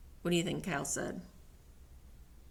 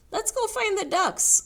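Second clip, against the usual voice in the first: high, louder eager suggestion; suggesting horse; 13 semitones higher, 5 dB louder than usual. Acted. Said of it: "suggested Horse".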